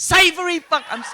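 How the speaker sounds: high, loud startled correction; horrified. Real, not acted. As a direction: catch yourself in shock. Horrified at own slip.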